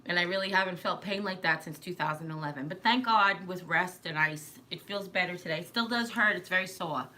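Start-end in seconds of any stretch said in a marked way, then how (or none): 6.4-7.1 SORE said New York style, without the R; New York "sore", ending on an open vowel; New York accent. Real, not acted. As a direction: drop the R in "sore" like a New Yorker. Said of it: "I forgot I'm from New York".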